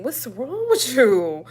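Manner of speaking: high, slow disbelief; incredulous. Retold or imitated. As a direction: stretch it out, scandalized. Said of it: "you be like".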